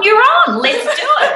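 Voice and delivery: high, laughing mock cheer; imitating an excited laughing yes; excited laughing quote. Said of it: "And he's gone".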